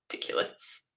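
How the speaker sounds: breathy whispered scoff; a mock-disgusted whisper. Real, not acted. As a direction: sneer it under your breath. Mock scorn at productivity.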